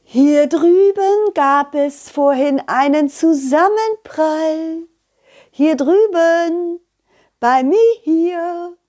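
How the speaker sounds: high, slow, drawn-out butterfly call; distant creature hollering in a high voice; calling out loudly. Acted. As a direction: call from far across the field, stretching each word. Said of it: "Ein Schmetterling rief ganz laut".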